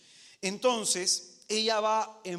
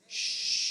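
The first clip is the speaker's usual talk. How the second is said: shushing. Acted. The Lord stays silent.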